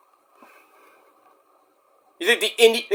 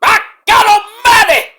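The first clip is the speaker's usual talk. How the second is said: high, loud wrestling-announcer cry; Jim Ross call; 8 dB louder than usual. Retold or imitated. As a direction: scream it like the legendary commentator. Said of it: "the great Gordon Solie and Jim Ross".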